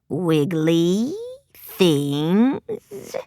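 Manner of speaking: very low, very slow scratchy hiss; scratchy monster whisper. Acted. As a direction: whisper like a hideous hungry beast. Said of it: "The scratchy whisper echoed".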